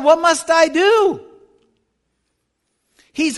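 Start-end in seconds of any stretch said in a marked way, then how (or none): 0.0-1.2 crying out. Pretend. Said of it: "they're crying out to God".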